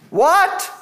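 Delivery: sharp high, loud disbelieving what; incredulous exclamation to the congregation. Real, not acted. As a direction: throw out a stunned what. Preacher reacts in disbelief.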